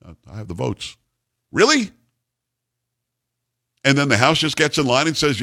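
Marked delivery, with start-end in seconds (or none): 0.1-1.0 mock McConnell. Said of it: "this guy comes up". 1.5-1.9 high, loud disbelief; incredulous. Real, not acted. Host scoffing at McConnell's claim, high incredulous.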